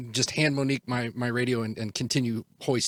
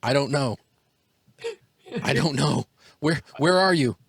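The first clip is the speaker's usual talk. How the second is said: frantic; 3 semitones higher, 5 dB louder than usual. Acted. Player frantic on radio.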